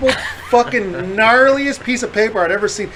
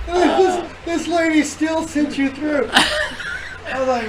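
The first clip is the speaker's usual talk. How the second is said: high, slow, laughing to tears; laughing so hard it sounds like crying; 8 semitones higher, 4 dB quieter, slower than usual. Real, not acted. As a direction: squeeze the words out through laughter. Helpless laughter after story climax.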